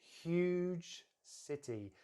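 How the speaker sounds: "huge" stretched very long, then "city"; high, slow emphasis on "huge"; lengthened stress. Shown as a demonstration. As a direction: draw out "huge" to show size. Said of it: "I'm gonna lengthen the huge".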